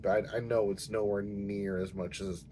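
ordinary talk

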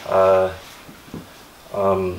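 normal talking voice

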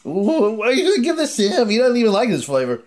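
put-on squeaky high kid voice. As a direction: pipe it up like a little kid.

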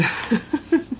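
high airy laugh; breathy laugh. Real, not acted. Reviewer laughing at the dragged demon, airy high laugh.

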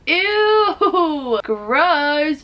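loud, high squeal of disgust; laughing disgust. Real, not acted. Vlogger grossed out by scabs in her nose, laughing disgust. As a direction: recoil loudly with a laugh in it.

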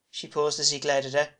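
plain reading voice

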